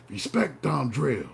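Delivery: slow, lightly voiced tough kangaroo; kangaroo voiceover. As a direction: drag out a hint of a swole kangaroo's threat. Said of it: "I named the kangaroo Don Drill in the voiceover".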